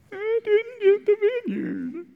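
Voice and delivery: put-on high, slow whimper like a sulking child; whiny crying. King Ahab to Jezebel, a high whiny sob. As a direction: whine it out like a little girl crying. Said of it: "and you start crying".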